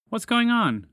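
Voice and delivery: high, loud startled asking; alarmed question. A narrator's reading. Spaceship sees creature frozen in chocolate, alarmed high question. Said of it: "Spaceship asked".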